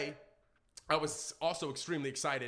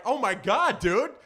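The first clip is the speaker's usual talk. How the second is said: excited; 6 semitones higher, 12 dB louder, slower than usual. Retold or imitated. Freaking out.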